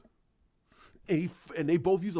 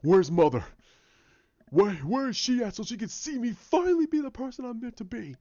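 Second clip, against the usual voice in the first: raised, higher voice of a retold confrontation; impassioned demand; 9 semitones higher, 6 dB louder than usual. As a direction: act out an impassioned demand mid-fight. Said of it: "he's going".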